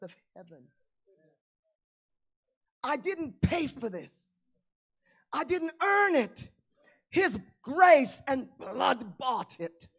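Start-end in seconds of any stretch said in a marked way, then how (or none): 2.8-6.5 rising, louder preacher's cry; preaching at full force. Acted. Pastor preaching grace to the congregation, rising fervor. 7.1-9.9 louder and slow, each word weighed; slow preached proclamation. Acted. Pastor proclaims grace to the church, slow and loud.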